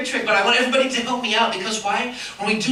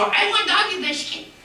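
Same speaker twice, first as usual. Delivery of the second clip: higher pleading puppet voice; begging puppet dog; 9 semitones higher than usual. Acted. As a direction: whine for the biscuit. Puppet Chico begs.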